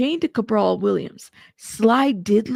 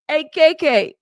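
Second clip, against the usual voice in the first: a louder, higher playful mock greeting; 5 semitones higher, 6 dB louder than usual. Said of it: "I could honestly hear the voice of [name]".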